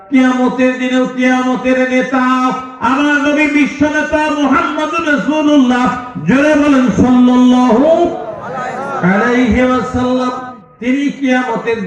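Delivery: high, rousing praise of the Prophet; full-force preaching. Real, not acted. Preacher calling the congregation to bless the Prophet, full force. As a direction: proclaim it high to the whole crowd.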